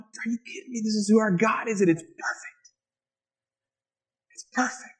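acted awe, half under the breath; hushed amazement. Acting out awe at God.

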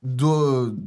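ordinary talk

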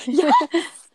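laughing, delighted; laughing yes. Real, not acted. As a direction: burst out laughing in relief.

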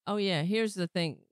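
usual speaking voice

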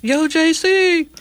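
high, drawn-out call to a friend; excited shout-out. Real, not acted. Shout-out to alumnus on air.

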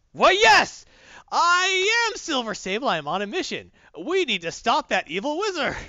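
very high, loud hammed-up heroic declaration; pompous heroine. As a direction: ham it up like a grand superhero. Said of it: "She's like".

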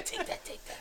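low-volume whispered quote; mock secretive whisper; imitated whisper. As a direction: whisper it like into someone's ear. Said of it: "He whispered in your ear".

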